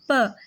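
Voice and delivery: a short, crisp "प" sound, said on its own; isolated letter sound. Shown as a demonstration. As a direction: clip "प" short and separate, like a teacher's demo. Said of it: "पे की sound है प". ("प" की साफ़ अलग आवाज़)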